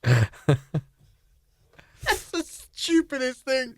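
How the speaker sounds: words squeezed out through helpless laughter; laughing. Real, not acted. Friend reacting to the spy joke, laughing helplessly. Laughing hard at the joke's punchline.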